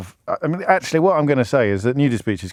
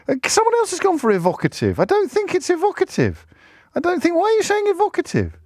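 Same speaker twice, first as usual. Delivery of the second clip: very high-pitched incredulity; baffled protest. Real, not acted. Presenter querying listeners who keep saying evocative, baffled. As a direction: squeak in disbelief at the suggestion.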